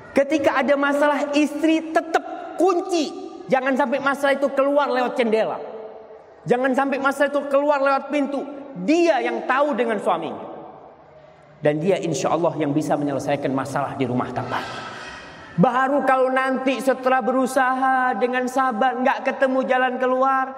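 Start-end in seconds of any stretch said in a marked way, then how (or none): 0.1-10.4 high, pressing sermon voice; fervent preaching. Acted. Preacher urging wives in the congregation, high fervent. 15.5-20.4 high, pressing sermon voice; fervent preaching. Acted. Preacher urging wives in the congregation, high fervent.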